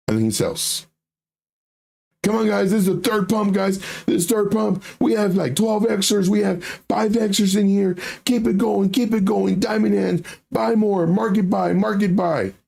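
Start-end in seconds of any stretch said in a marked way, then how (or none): 2.2-12.5 rally the group to keep buying; crypto bro. Retold or imitated. Voicing pump group owner.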